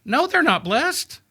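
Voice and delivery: an indignant onlooker rejecting the idea; scoffing objection. Retold or imitated. Crowd member objecting to Jesus, high mock indignation. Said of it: "people in the crowd are like".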